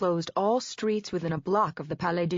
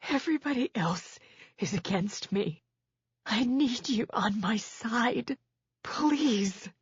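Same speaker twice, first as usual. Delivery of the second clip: hoarse, half-voiced plea, slower; rasping pleading whisper; 4 semitones higher, slower than usual. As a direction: she forces the words through a tight throat. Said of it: "I tried again in a hoarse whisper".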